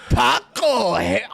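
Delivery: high, loud, slow hammed-up gangster voice; Paco voice. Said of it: "The, the Paco character".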